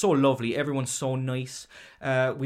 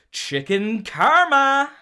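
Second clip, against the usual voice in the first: dramatic reveal; 13 semitones higher, 10 dB louder, much slower than usual. Real, not acted. Punchline announced dramatically.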